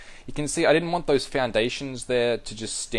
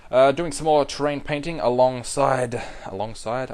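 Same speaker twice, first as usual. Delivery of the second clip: normal talking voice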